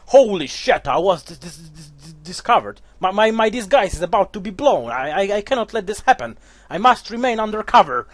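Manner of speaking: loud, high panicked villain; hammed-up villain caught out, then threatening; hammy Naga wizard blowing her cover. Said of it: "that's definitely her voice".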